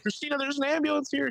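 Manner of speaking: high-pitched, excited calling out; mock urgent alert. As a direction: retell breaking urgent news to your partner. Retells alerting partner indoors.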